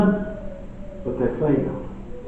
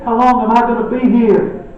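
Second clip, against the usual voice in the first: higher, louder exasperated sigh of a question; 9 semitones higher, 6 dB louder than usual. Retold or imitated. Jesus fed up with the disciples' lack of faith, exasperated. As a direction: throw up your hands in frustration. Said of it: "He's pained. He's exasperated".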